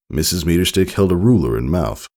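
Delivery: ordinary delivery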